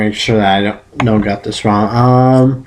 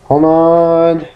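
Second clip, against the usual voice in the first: ordinary talk, nothing marked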